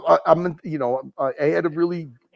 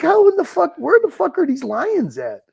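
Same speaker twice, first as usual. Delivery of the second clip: retold disbelief; 13 semitones higher than usual. Retelling his own reaction to the caged lions, high incredulous. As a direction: ask in mock disbelief where the lions are. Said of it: "I was like".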